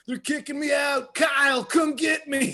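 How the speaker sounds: high yelled plea from across the room; high drunken shout for a friend. Retold or imitated. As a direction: yell it high like a kicked-out drunk. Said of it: "I just hear".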